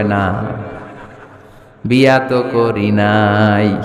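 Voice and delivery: low, slow, drawn-out reply; mock sheepish admission. Man admitting to the cleric he never married, drawn out low. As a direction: drag out the imitated confession down low. Childless man confesses he is unmarried.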